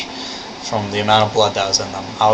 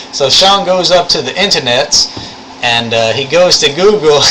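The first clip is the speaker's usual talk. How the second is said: raised, animated Southern storytelling voice; thick Southern accent; 4 semitones higher, 3 dB louder than usual. Shown as a demonstration. Showing viewers how the lab instructor talks, thick Southern accent. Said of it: "only with a thick Southern accent".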